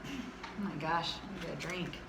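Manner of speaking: normal talking voice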